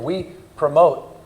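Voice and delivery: slowed right down, each verb set apart; deliberate slow emphasis. Real, not acted. Speaker to audience, unpacking the award, slow and deliberate. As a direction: spell it out word by word for effect.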